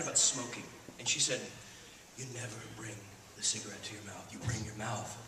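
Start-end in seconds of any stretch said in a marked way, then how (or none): 2.2-5.2 low, soft, with deliberate pauses; hushed, measured advice. Retold or imitated. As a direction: impart it quietly like a secret technique. Quoting Debbie Reynolds's smoking advice.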